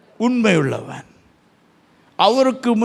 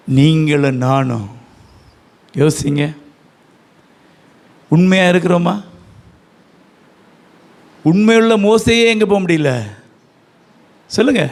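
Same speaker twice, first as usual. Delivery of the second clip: full-force preaching; 4 semitones lower, 5 dB louder than usual. Acted. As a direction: thunder the question at them. Preacher challenges congregation loudly.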